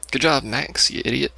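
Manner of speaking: ordinary talk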